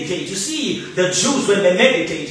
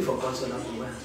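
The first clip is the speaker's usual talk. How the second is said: fast, soft, low murmur; hushed recitation; murmured scripture; 10 semitones lower, 13 dB quieter, much faster than usual. Shown as a demonstration. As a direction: mutter the verse quietly to yourself. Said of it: "When you meditate".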